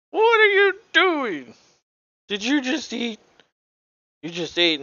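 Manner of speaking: hammed-up taunting falsetto; mocking trash talk. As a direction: tease him in a silly high put-on voice. Voicing player mocking opponent.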